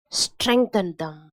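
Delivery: normal talking voice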